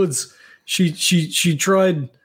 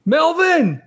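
calling out; 11 semitones higher than usual. Acted. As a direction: shout for him across the house. Calling out for missing friend.

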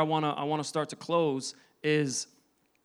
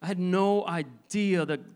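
ordinary talk, nothing marked

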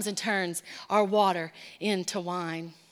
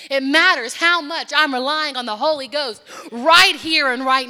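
louder, driving sermon climax; full-force preaching; 3 semitones higher, 7 dB louder than usual. Acted. Pastor drives his point home to the church, loud preaching. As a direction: hammer each line home to the congregation.

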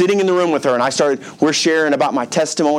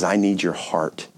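voice of God; 7 semitones lower, 5 dB quieter than usual. Retold or imitated. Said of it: "And God says".

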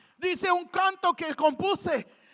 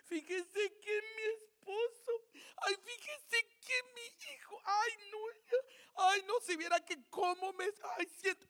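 mock crying; 3 semitones higher, 12 dB quieter than usual. Preacher mimicking believers who cry over their trials, mock tearful whimper. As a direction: imitate a tearful complainer, sobbing through woes. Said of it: "en vez de estar llorando".

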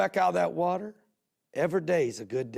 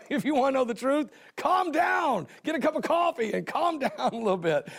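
laughing shout; 10 semitones higher than usual. Real, not acted. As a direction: laugh it out like you're telling off a hothead. Playful road-rage advice to viewers.

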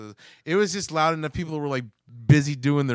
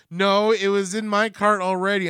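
higher, louder retold protest; lightly voiced old lady; 5 semitones higher, 5 dB louder than usual. Old lady disputing the cart, lightly imitated protest. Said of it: "She goes".

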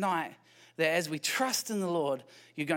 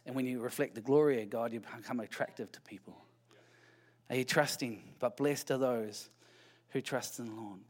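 quiet, intimate sermon voice, much softer; hushed preaching; 7 semitones lower, 6 dB quieter than usual. Acted. Preacher to congregation, softly confiding the promise. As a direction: draw them in gently, almost confiding.

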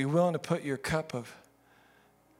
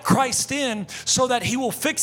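rising prayer plea; 7 semitones higher, 4 dB louder than usual. Acted.

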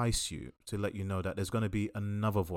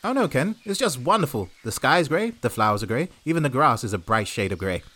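1950s radio presenter; 3 semitones higher, 5 dB louder than usual. Acted. Reporter Tony cheerfully reports grey skies to Ken, fifties radio voice. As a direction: gush like a fifties wireless host. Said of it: "Like you're a nineteen fifties radio presenter?".

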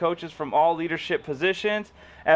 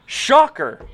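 sarcastic; 8 semitones higher, 8 dB louder than usual. Real, not acted. Sarcastic reaction to statement.

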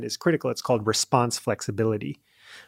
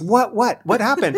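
high, louder imitation of a baffled wife; puzzled questioning; 9 semitones higher, 4 dB louder than usual. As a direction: quote her quick, bewildered questions. Retells puzzled wife's questions.

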